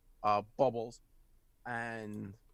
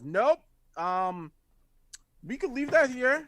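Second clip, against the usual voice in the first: high-pitched, quick refusal then hedging; character refusing; 10 semitones higher, 4 dB louder, slower than usual. Acted. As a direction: refuse it in her higher voice. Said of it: "and she goes".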